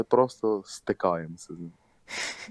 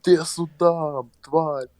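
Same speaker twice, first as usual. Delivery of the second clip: higher, slow drawn-out shout; retold yell after the runaway; mock yell; 7 semitones higher, 5 dB louder, much slower than usual. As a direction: drag out a threatening shout. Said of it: "Той чувак кричить".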